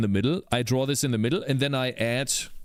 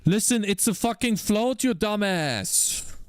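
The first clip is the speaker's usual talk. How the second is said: angry outburst; 8 semitones higher than usual. Real, not acted. Coder losing patience with a buggy float, angry snap. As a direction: angrily scold the program, dragging out dumbass. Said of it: "I'm getting angry here".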